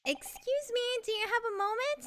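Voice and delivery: high-voiced, insistent polite request; calling for attention. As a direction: pitch it up to get someone's attention. Calls again to get attention.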